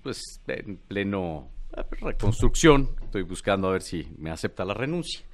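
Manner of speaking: halting politician impression, long pauses mid-sentence; Ebrard. Comedian answers host as the politician in his stage show, halting impression. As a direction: drag the words out, hesitating between phrases. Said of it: "¿cómo habla Ebrard?".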